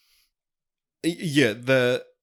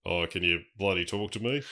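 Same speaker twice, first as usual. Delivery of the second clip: a quiet, low hint of a Kiwi Alan Grant; New Zealand accent; 4 semitones lower, 7 dB quieter than usual. Co-host asks for an Alan Grant impression, New Zealand accent. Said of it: "do your best Doctor Alan Grant impression".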